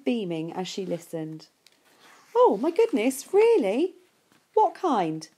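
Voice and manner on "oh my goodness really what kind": high, loud burst of delighted surprise; thrilled by the news on the phone; excited. Acted. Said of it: "she said excitedly".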